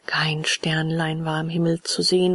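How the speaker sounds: usual speaking voice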